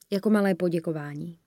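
usual speaking voice

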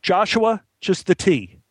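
high-pitched command; ordering. Acted. Assistant director passing the order to the boy, commanding. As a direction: issue a sharp order to an underling. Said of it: "The A D ordered".

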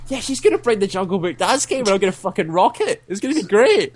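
high-pitched, hyped-up anticipation; retold excitement. Friend retelling his reaction to the Jungle Book game, excited. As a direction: gush about it, pitch climbing.

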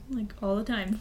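breathy unvoiced whisper. Real, not acted. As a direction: drop to a whisper. Whispered aside.

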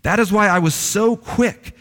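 a hint of a sulking prophet crying out; angry Jonah praying. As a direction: lift into a sung, complaining prayer. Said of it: "this is why he's angry".

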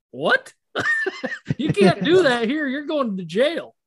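high-pitched mock outrage through laughter; laughing disbelief. Visitor shocked at shooting from a truck, laughing disbelief.

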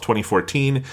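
ordinary talk

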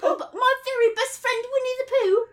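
high-pitched English schoolboy; little British boy accent. Acted. Christopher Robin greets Piglet in a little British boy accent. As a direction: announce yourself as a small British boy. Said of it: "your little British boy accent".

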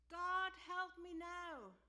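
loud, slow, desperate cry to God; urgent prayer voiced loud and slow; urgent plea. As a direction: cry out for help, drawn out.

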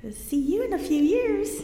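high, put-on sing-out of doubt; knowing mock look. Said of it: "y'all used to give her the look".

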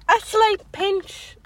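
high, drawn-out mocking repeat; laugh-scoffing at the words; incredulous. Real, not acted. Mocking the doctor's phrase.

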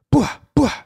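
two high popped gunshot noises; sharp high "bwah" for each shot; imitated gunshots. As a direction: mimic two shots going off.

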